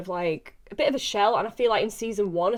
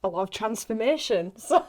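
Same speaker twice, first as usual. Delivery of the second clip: ordinary talk, nothing marked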